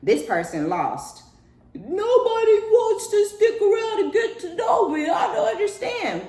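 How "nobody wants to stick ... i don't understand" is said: high, loud mocking wail; mock whiny wail. Lost person complaining nobody stays, high mocking wail. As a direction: wail it high like a clueless victim.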